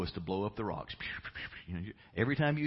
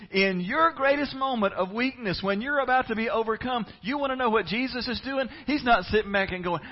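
louder, higher, driving preacher voice; full-force preaching; 10 semitones higher, 7 dB louder than usual. Acted. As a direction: preach it to the rafters.

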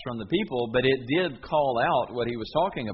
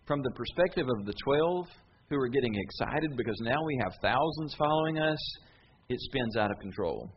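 usual speaking voice

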